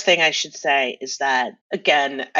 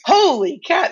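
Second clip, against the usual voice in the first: higher and louder, a mock gasp of amazement; amazed exclamation; 11 semitones higher, 5 dB louder than usual. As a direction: blurt it out like you just struck gold. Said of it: "I was like".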